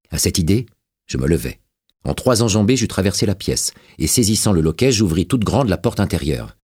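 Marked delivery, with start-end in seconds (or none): none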